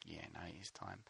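softly imitating a kind vet; gentle vet. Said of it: "He's like".

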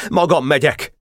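high, desperate cry; desperate shout. A narrator's reading. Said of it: "kiáltott kétségbeesve Boka". (kétségbeesett, magas hangú kiáltás)

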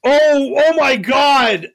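sudden realisation, high and slow; oh my God, it just hit me; surprised. Real, not acted. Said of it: "I didn't even rem".

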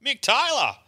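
called out high across the bar; calling out. Retold or imitated. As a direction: hail him by name, pitched high. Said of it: "I walk up and went".

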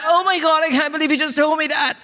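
high-pitched appalled daughter; mortified teenage daughter. Acted. Daughter to mom who overshared, mortified. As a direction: squeal it like an embarrassed teen.

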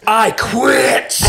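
hammed-up high shout; triumphant quitting yell. Salesman quitting as he walks out on boss, defiant shout. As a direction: holler a grand, defiant exit.

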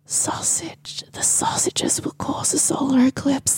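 hushed, breath-only whisper of revelation; awed whisper. Pretend. Host repeats the eclipse revelation after gasping, awed whisper. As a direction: whisper it as if the prophecy stuns you.

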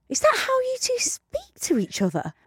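a stranger asks the couple nervously; timid, wary question. Retold or imitated. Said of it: "turned around really slowly and scared".

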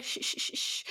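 soft unvoiced shushing; mock soothing shh. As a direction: hush him gently like a nervous child. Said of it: "Alice goes up to him and is like".

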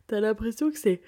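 yawning. Real, not acted. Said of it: "je baille".

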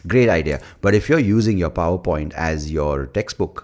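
normal talking voice

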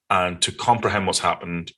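normal talking voice

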